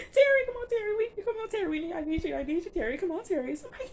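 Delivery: mock panicked crying. Retelling Wanda pleading over shot Terry, mock panicked crying. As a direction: beg him to wake up, sobbing. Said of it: "She freaking out".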